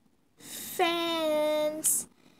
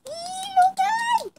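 very high delighted squeal; high fangirl squeal; 5 dB louder than usual. Acted. A fan squeals on seeing her idol. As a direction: let out a starstruck eee.

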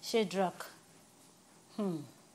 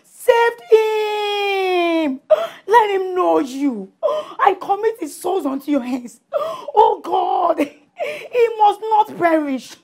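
tearful pleading prayer, speaking in tongues between sobs; fervent prayer; 8 semitones higher, 16 dB louder, slower than usual. Acted. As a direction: beg God for your son, weeping and crying out. Mother prays fervently for son.

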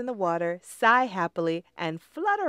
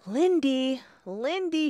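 worried shriek; 6 semitones higher, 4 dB louder than usual. Acted. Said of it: "shrieked Molly".

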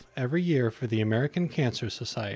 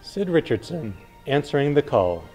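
hard sell ad; 5 dB louder than usual. Acted.

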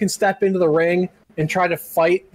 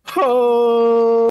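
drawn-out, raised "ho"; long mock wrestling holler; imitating wrestler's call; 4 semitones higher, 4 dB louder than usual. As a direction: hold out a wrestler's battle cry. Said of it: "And he's like".

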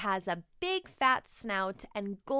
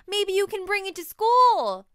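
eager suggestion; 10 semitones higher, 12 dB louder than usual. A narrator's reading. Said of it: "says Sam".

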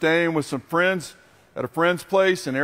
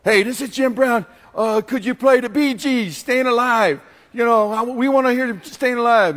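a lightly put-on, higher teenage caller voice; teen radio caller; 6 semitones higher, 3 dB louder than usual. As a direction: play the eager kid calling the station. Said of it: "he would go".

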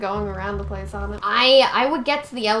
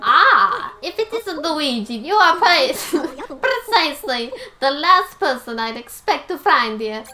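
higher, hammed-up professor greeting; an improvised voice for the professor, breaking briefly into a laugh; put-on professor voice; 3 semitones higher, 4 dB louder than usual. Professor greets Luigi in the hotel, put-on voice. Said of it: "what is his voice".

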